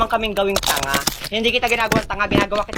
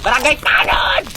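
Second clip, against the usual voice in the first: calling out; 6 semitones higher, 4 dB louder, slower than usual. Acted. Calling for the village watchman.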